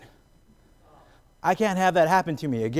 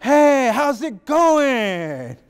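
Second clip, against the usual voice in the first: much higher, louder, very slow greeting; weird accent pickup line; 12 semitones higher, 8 dB louder, much slower than usual. Retold or imitated. Trying his own accent on a girl, high, loud and slow. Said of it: "I'm like".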